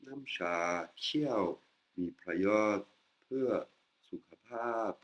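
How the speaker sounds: "Namcha kiao mi prayot pe sukapap" read very slowly with careful tones; slow deliberate Thai model read; slow Thai sentence. Shown as a demonstration. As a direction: spell out each Thai word for the learner. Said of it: "full sentence read slowly".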